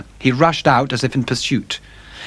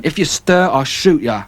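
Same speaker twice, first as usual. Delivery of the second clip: menacing threat; 4 semitones higher than usual. Acted. Robber threatens Dorian.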